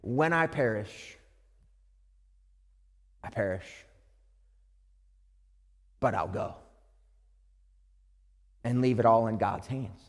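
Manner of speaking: hushed surrender to God; resigned. Retold or imitated. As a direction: let it go, quietly giving in. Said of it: "a statement of resignation".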